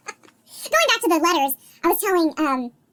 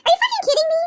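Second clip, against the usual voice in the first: much higher, half-laughing mock outburst; clear-as-a-bell toddler; 10 semitones higher, 4 dB louder than usual. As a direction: lightly imitate a toddler's clear, incredulous exclamation. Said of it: "My two-year-old clear as a bell".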